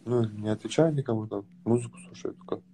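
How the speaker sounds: ordinary delivery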